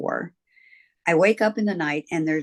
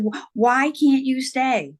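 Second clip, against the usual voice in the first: put-on slow, higher childlike why; imitated child pleading; 8 semitones higher, 4 dB louder, slower than usual. Child asks departing friend Robbie to stay, slow higher plea. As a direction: ask it slowly, like a small hurt child.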